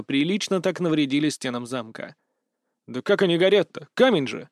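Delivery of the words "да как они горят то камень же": usual speaking voice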